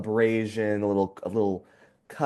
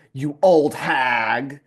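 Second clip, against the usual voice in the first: put-on mean girl sneer; 3 dB louder, much slower than usual. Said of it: "Debby Ryan's like".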